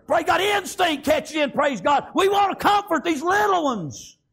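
high, shouted revival preaching; impassioned preacher's shout; fervent preaching. Real, not acted. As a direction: cry it out to the congregation. Preaches at full force.

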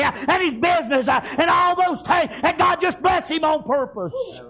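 full-voiced Southern preaching. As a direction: build the story up from the pulpit. Preaching the answered prayer story.

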